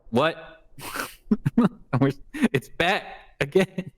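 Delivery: high, laughing, it's back again; excited laughing disbelief. Real, not acted.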